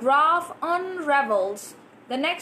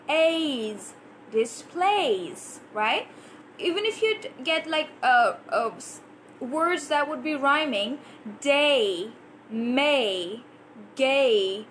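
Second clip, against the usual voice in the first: usual speaking voice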